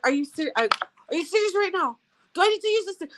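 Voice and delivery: high, mock-stern threat; mock stern mom. Mom threatening misbehaving kids, hammed-up stern voice. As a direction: pretend to threaten the kids with the stick. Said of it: "I'm like".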